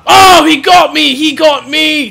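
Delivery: high, loud cry of being hit; alarmed outcry. Real, not acted. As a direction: cry out as if just struck. Gets hit in the game.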